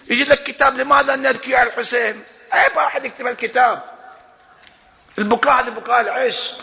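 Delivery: high, loud fervent preaching on weeping; impassioned preaching. Real, not acted. Preacher to congregation on why they weep, fervent and loud. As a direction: preach it with rising fire and conviction.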